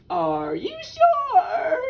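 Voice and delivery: very high, loud, stretched question; high, slow storybook rooster voice; doubtful rooster. A narrator's reading.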